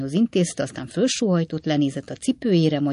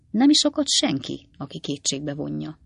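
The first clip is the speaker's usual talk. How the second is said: plain reading voice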